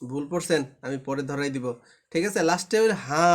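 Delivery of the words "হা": "হা" held long on the vowel; sustained recitation-style "হা"; one-alif stretch. Shown as a demonstration. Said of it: "একালিফ টেনে".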